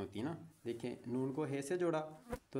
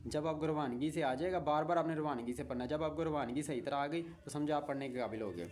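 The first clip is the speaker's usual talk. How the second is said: ordinary talk, nothing marked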